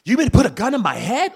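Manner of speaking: high, loud mock confrontation; aggressive. Mocking the aggressive way to answer a gunman, loud and high. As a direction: bark it like a hothead picking a fight. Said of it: "trying to be all aggressive, like".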